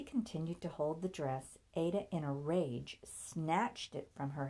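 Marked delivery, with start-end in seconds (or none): none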